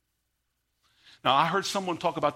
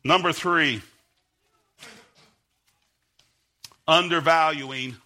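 fiery preaching; 6 semitones higher, 6 dB louder than usual. Acted. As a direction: hammer it home to the congregation at full force. Preacher at full force.

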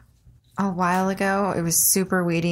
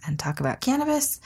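normal talking voice

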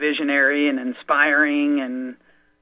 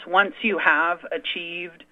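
ordinary delivery

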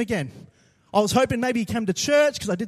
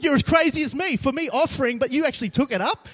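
usual speaking voice

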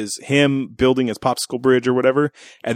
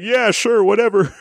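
high-pitched, hurried-to-please yes; hasty agreement; 9 semitones higher than usual. Acted. Spared man agreeing to the gargoyle's terms, high placating voice. Voicing man's quick agreement to monster.

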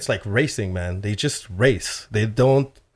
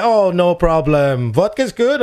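jovial host; 6 semitones higher, 5 dB louder, slower than usual. Retold or imitated. Imitating Russian host.

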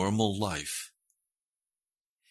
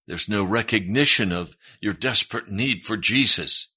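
louder, insistent sermon voice; fervent preaching; 3 semitones higher, 7 dB louder than usual. Acted. Pastor preaching to the congregation, loud and fervent. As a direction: press each claim home like a preacher.

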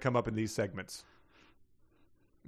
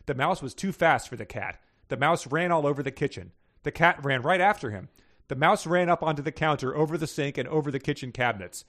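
animated read-aloud of a story; story narration; 3 semitones higher than usual. A narrator's reading. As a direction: read the tale out clearly and briskly. Reads submitted story aloud.

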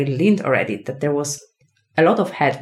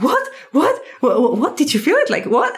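startled husband; 10 semitones higher than usual. Retold or imitated. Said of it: "Yasser was like".

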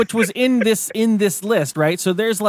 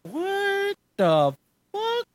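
startled disbelief; 5 dB quieter, much slower than usual. Retold or imitated. Alarmed at phone ringing.

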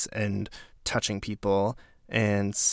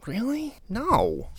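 incredulous scoff; 12 semitones higher, slower than usual. Real, not acted. Mocking the offer.

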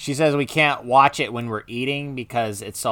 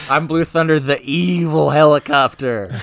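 evil helicopter villain; 3 semitones higher, 7 dB louder than usual. Pretend. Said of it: "the role of Blue Thunder".

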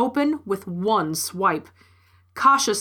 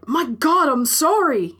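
raised-pitch, flustered exclamation; shaken apology; 5 semitones higher, 4 dB louder than usual. A narrator's reading. Driver to the woman he nearly hit, flustered apology. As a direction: blurt out an alarmed apology.

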